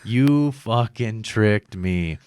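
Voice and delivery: very slow, dragged-out accusation; drugged mock accusation. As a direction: draw each word out as you accuse the nurse. Repeats groggy accusation of the nurse.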